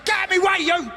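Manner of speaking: hammed-up Greg shouting; fired-up Greg. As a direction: bellow it like a hyped-up presenter. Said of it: "he goes".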